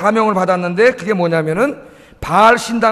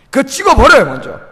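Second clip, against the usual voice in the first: higher, louder call to cut it down; preacher loudly urging the congregation; urgent exhortation; 4 semitones higher, 5 dB louder than usual. Real, not acted. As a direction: push the listeners to act now.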